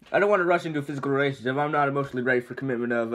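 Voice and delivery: rapid-fire nervous babbling; nervous babble. Acted. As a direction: rush the words out in a panic. Donkey babbling nervously to dragon.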